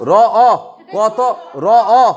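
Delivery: র, অ, ক, ত, অ sounded out one by one, loud and separate; loud teacher sound-by-sound drill; phoneme by phoneme. Shown as a demonstration. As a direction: spell out each sound of রক্ত. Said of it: "clear?".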